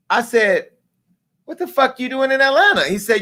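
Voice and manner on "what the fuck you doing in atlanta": much higher, outraged disbelief on Atlanta; shocked disbelief. Retold or imitated. On the phone with the guy at the airport, shocked and much higher. Shocked caller flown in by accident.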